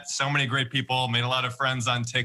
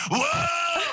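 whoa scream. Acted. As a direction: let out a wild high whoa. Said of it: "It's just like fifty percent scream".

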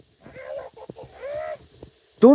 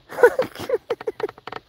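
stifled laugh; 10 semitones higher than usual. Real, not acted. Laughing at sleeping woman.

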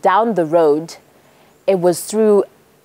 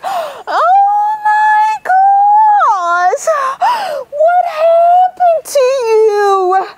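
very high, slow, louder shocked wails; a church lady shrieking high at the wounded man; 4 dB louder, much slower than usual. A narrator's reading. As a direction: shriek in horror at the blood.

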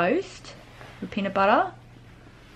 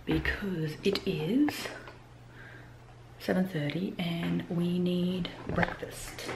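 soft slow whisper so as not to startle; 9 dB quieter, slower than usual. Real, not acted. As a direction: hush it, the little one is barely awake. Early morning, child just waking.

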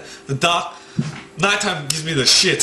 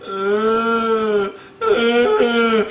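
drawn-out higher wail; 5 semitones higher than usual. Retold or imitated. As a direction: imitate a crying baby-like cat.

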